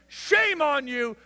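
high, loud, slow scold; shaming father. Retold or imitated. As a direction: drag out shame in scorn. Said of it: "where was his dad".

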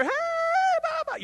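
very high, squealed party cry; mock the carefree partier; mock party reveler. Carefree sinner out partying, squealed high.

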